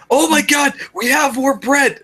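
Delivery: high, slow, giddy nerd voice; Neil Goldman voice. Retold or imitated. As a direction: squeal it like an overexcited nerd. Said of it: "I love to do Goldman voice".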